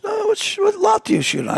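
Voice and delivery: high-voiced mother asking a question; imitated mother. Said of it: "She goes".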